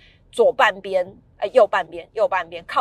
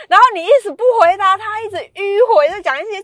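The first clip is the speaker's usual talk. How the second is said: much higher and louder exasperated complaint; exasperated rant; 12 semitones higher, 10 dB louder than usual. Real, not acted. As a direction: vent at her dodging. 吐槽妈妈绕圈不答. (女儿气急败坏高声吐槽)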